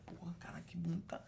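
hushed, breathy whisper of admiration; whispered leer. Retold or imitated. Man whispering to a friend about a woman's body, hushed. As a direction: whisper it like a guy ogling a woman. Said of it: "você fala".

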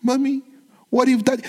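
put-on high, thin boyish voice; a timid boy pleading with his mother. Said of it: "Jacob saying with his thin voice".

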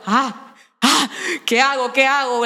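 gasp, then dither frantically; mock panic. Actress getting Alex's call on the toilet, flustered mock panic.

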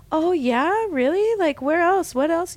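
sarcastic wide-eyed curiosity pitched high; fake-impressed. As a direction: play along, gushing and high like you're impressed. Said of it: "And I go".

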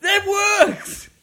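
high, loud burst of astonishment; amazed shout. Real, not acted. Amazed at the sudden accent.

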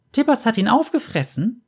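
put-on high child's voice with a playful lilt; playful little girl. A narrator's reading. Girl playfully tells the bounty hunter her bear ate him, high voice. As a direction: tease innocently, pitched high. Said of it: "ein spielerisches Lächeln".

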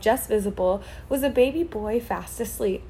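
ordinary delivery, nothing marked

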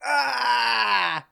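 high, falling "aah" yell; drawn-out cry. Real, not acted. Co-host watching the skate run, drawn-out cry. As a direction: let out a long, high cry.

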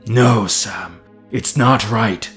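firm, understated anger; mounting anger. Acted. Master refusing his servant's soothing, mildly angry. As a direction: insist with a touch of anger. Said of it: "sounding angrier than Sam had ever heard him".

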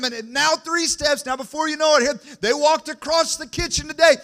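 high, loud, rising preacher's cry; preaching at full force. Acted. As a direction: preach it out to the whole church.

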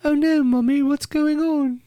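put-on high, small English child's voice; little kid. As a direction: play a worried little kid from a video game. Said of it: "it was the little kids".

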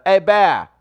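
loud, high Irish challenge; Irish accent. Acted. Playing Conor McGregor confronting a bear, loud Irish accent. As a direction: call it out in a bold Irish accent. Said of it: "This is my Irish accent".

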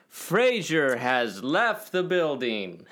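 slow, drawn-out announcement; mock announcer line. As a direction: stretch it out like a showy announcer. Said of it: "for him to say".

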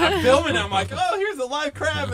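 high and loud, bubbling with glee; thrilled retelling of the live crab; excited retelling. Retold or imitated. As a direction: squeal it out as the story peaks.

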